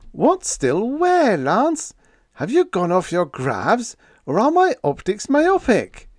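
high, loud, slow bewildered demand; exasperated disbelief. A narrator's reading. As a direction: demand it baffled. Radioman exasperated questioning.

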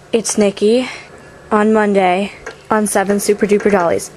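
ordinary talk, nothing marked

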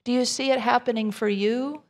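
usual speaking voice

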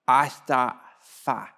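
put-on slow, childlike mumble of a two-year-old; toddler voice. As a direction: mimic a toddler who can't say his consonants yet. Toddler son mispronouncing words.

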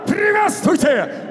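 ordinary delivery

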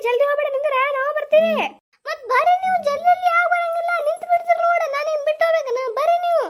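lightly voiced kid calling after the family; a hint of a whiny child.

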